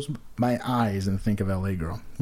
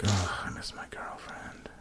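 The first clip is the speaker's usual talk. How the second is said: lower, slow whispered lament; mock whisper; 4 semitones lower, 10 dB quieter, slower than usual. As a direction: whisper it like a sad confession. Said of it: "and be like".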